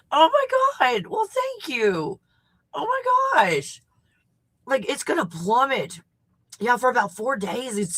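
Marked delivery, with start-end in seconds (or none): 0.1-3.8 very high, slow, amazed thanks; bowled over by an unexpected gift; overwhelmed gratitude. Real, not acted. Overwhelmed by free cabin offer.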